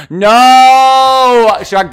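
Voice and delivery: long, high, loud cry of correction; loud, stretched-out protest; excited outcry. Real, not acted. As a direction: shout it high and drawn out. Excitedly correcting co-host with remembered name.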